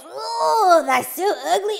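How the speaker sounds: high, loud revolted exclamation; exaggerated grossed-out cry; disgusted boy. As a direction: recoil from the ugly fish. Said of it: "he goes".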